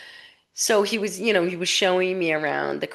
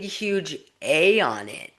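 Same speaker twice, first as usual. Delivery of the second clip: ordinary talk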